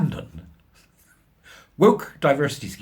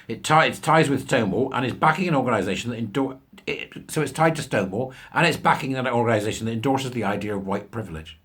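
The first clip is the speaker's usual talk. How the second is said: ordinary delivery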